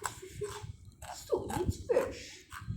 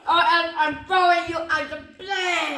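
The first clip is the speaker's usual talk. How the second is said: furious yell, much louder; angry shout; 13 dB louder than usual. Acted. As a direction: bark the threat at full volume. Threatens to throw him out.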